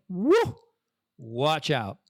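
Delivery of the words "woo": high whooping woo; excited woo. Retold or imitated. Retelling a friend's five o'clock drinking, a high excited woo. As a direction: cheer like the clock struck five.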